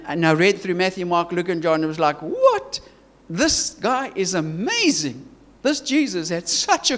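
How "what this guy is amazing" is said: retelling his own wide-eyed astonishment; amazed. Retold or imitated. Preacher recalls first reading of the Gospels, amazed.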